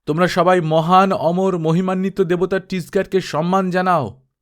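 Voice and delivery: high, loud ritual proclamation; booming call to honour the god; priest's shout. Acted. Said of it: "চেঁচিয়ে উঠল".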